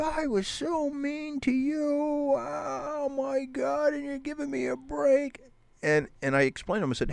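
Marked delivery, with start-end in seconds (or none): 0.0-5.4 much higher, slow mock-crying voice; high, slow imitated sobbing plea; imitating crying. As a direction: wail it like a big man breaking down. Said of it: "And he starts crying".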